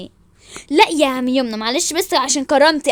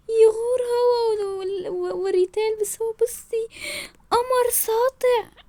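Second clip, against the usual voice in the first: high, slow, drawn-out wail; tearful lament; 7 semitones higher, 4 dB quieter, much slower than usual. Acted. Lovesick woman laments over handsome man, tearfully wailing. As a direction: whimper it out, soft and high.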